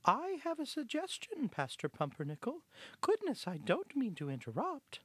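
small high voice of a polite little mouse; timid, high-pitched mouse speaking up. Acted. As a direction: pipe up high and apologetic. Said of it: "timid voice of Hortense the little mouse organist".

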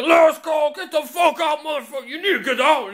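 high-pitched shouted mock bouncer; screaming last call. Bar staff clearing drinkers at closing time, mock screaming. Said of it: "they just start screaming the fuck at you".

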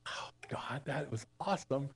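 a hushed whisper of awe to God; whispered prayer. Retold or imitated. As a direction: breathe it as a whispered prayer, amazed. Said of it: "I was just like".

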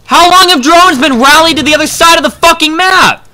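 loud, high-pitched furious yell; exasperated shouting; real gamer rage. Real, not acted. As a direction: scream it in outrage at your own blunder. Raging at misplaced drones in game.

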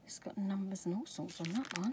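hushed admiring whisper; soft whispered aside. Real, not acted. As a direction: whisper the details close to the mic. Pointing out stencil details.

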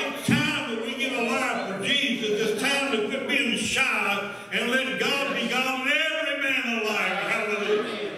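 high, driving scripture proclamation; full-force preaching. Real, not acted. Preacher rousing the church.

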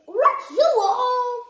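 loud, slow call to everyone. Real, not acted. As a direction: call the group together. Calling the group.

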